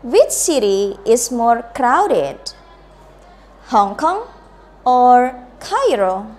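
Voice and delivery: slow, with "Hong Kong" rising and "Cairo" falling; slow choice-question intonation. Shown as a demonstration. Teacher modelling the second example, slow rise then fall. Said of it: "Listen to me and then you can repeat".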